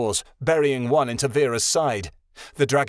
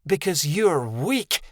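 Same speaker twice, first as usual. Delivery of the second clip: snapped; 4 semitones higher, slower than usual. A narrator's reading. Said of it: "snapped back at him".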